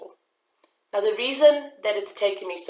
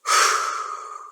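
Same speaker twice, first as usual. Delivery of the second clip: deep sigh. Real, not acted. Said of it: "take a deep breath".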